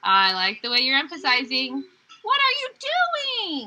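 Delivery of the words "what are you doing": much higher and slow, pushing "doing"; stress on do. Shown as a demonstration. Teacher demo for the class, high and slow with "doing" stressed. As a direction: lift it way up and stretch it, weight on "doing". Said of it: "and we said "do" really powerful".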